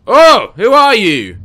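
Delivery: loud, slow startled question; wary surprise at a stranger; surprised. Real, not acted. Meets a strange figure.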